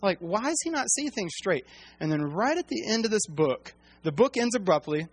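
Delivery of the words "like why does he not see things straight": higher, incredulous mock exasperation; a hint of fed-up disbelief, said lightly; exasperated scoff. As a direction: scoff at someone who can't see it. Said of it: "we've been like".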